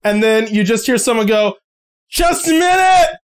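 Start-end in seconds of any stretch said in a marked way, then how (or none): 2.1-3.2 high shout from inside the house; called out. Acted. Resident shouting to visitors at the door, high shout.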